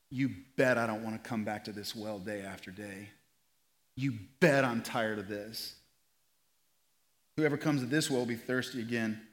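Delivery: hushed, tender sermon delivery. Acted. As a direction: let it sink in gently. Soft reflective preaching.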